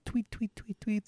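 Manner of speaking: high, soft tweeting; imitated dainty birdsong. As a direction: chirp lightly like a sweet little songbird. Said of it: "not a lot of likes".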